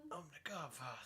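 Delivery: very hushed, strained Godfather impression; badly attempted mobster voice, barely above a murmur. Uncle trying to play the film boss, strained Godfather impression. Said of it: "I, see, I can't do it".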